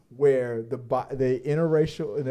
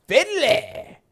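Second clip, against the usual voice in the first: high announcing voice; 3 dB louder than usual. Real, not acted. Announcing the film title.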